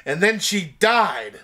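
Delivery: slow, heavy punchline delivery; dramatic slow reveal. Acted. Host ending the fake plot, slow and heavy. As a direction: drag out the final word.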